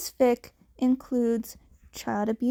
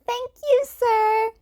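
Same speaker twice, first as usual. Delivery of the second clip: much higher, louder, sweet; polite kid Deku; 11 semitones higher, 5 dB louder than usual. A narrator's reading. Cat kid thanking Yamada for food, high and sweet. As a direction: thank him like a polite little boy.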